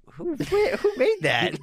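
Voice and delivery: very high, slow, stalling; high drawn out. Real, not acted. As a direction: stretch the who out high. Stumped, stalling for an answer.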